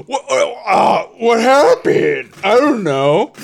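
high, loud, drawn-out feigned bewilderment; hammed-up "who, me?" shock, nearly shouted; mock shocked innocence. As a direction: play dumb at the top of your voice. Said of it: "And he's like".

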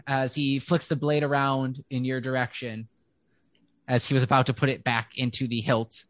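ordinary talk, nothing marked

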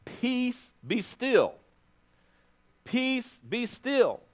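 high, ringing command on "Peace"; Jesus commanding the storm to calm. Acted. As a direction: speak to the storm with authority. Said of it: "it says".

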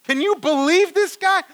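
high, loud, hammed-up disbelief; shocked disciples. Disciples listening to Jesus's story, high incredulous outburst. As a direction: cry out in outraged astonishment. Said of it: "That's what they're looking like".

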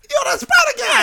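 very high, shouted street heckle; squealed New Yorker impression; mock New York guy. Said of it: "not how the New York guy sounded".

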